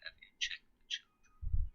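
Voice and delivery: slow, unvoiced eerie stalker whisper; creepy whisper. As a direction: breathe it like a menacing caller. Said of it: "they keep saying".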